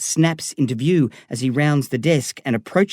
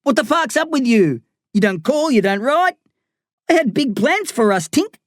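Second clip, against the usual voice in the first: Darren, cocky gangster; 11 semitones higher than usual. Acted. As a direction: rib your old mate with swagger. Darren's voice in the story.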